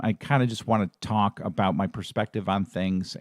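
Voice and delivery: usual speaking voice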